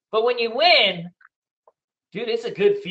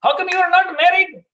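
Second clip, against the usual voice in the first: nagging mom; 7 semitones higher than usual. Retold or imitated. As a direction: nag like a mom on FaceTime. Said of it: "my mom calls and FaceTimes me".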